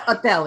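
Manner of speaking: "hotel" said as "oh-tel"; silent H. Retold or imitated. Trainer quoting the puzzling word, "hotel" with silent H. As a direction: drop the H from "hotel", as a learner would.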